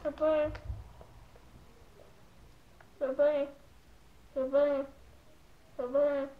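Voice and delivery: garbled through stuffed cheeks; cheeks stuffed full. Real, not acted. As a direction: mouth full of marshmallows. Still stuffed with marshmallows.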